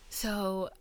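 ordinary delivery

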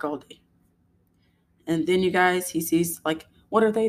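normal talking voice

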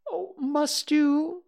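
high, drawn-out polite question. A narrator's reading. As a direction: politely feign regret, pitched high. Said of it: "said Rabbit politely".

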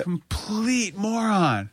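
high, slow, drawn-out self-insult; mock self-insult. Real, not acted. Calling herself a moron about meeting Joey, drawn out and mocking. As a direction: stretch it out, mocking yourself.

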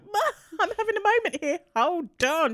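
high-pitched mock whine to mum; acted high, put-upon plea; retold protest.

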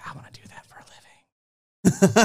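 loud, forceful mock whisper; excited stage whisper. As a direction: whisper it hard, as a secret thrill. Retold private reaction to creepiness.

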